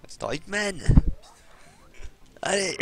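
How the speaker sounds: ordinary talk, nothing marked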